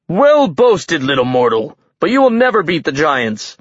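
high, loud boast for a crowd; shout. A narrator's reading. Giant king performing for his followers, loud shout. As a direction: proclaim it to the onlookers. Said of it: "shouted for the onlookers".

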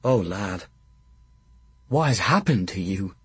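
a catch of grief in the throat; voice breaking. Acted. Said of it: "My voice broke".

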